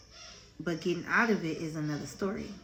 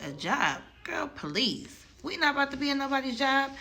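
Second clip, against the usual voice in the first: acted-out scoff, climbing higher and faster; scornful dismissal; 7 semitones higher than usual. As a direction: wave off the idea, rising into quick disbelief. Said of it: "A job was not even an option 'cause we was like".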